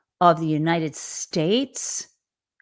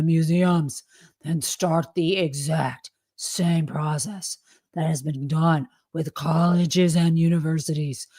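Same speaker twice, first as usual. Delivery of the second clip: stupid voice. Acted. Host mocking Trump's post so you know it's not me, stupid voice. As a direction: read it in a dumb put-on voice, stretching the key words. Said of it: "Let me speak in a stupid voice".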